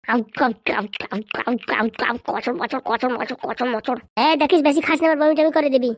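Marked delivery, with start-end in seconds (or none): 0.1-2.2 low, soft voiced glugs; exaggerated glug-glug of downing liquor; mimed gulping noises. Drinks again, imitated gulps. 2.3-4.0 low, rhythmic crunching syllables; comic imitation of crunching a snack; mimed munching noises. Imitates munching while drinking.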